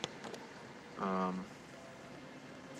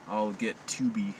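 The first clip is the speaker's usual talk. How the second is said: ordinary talk, nothing marked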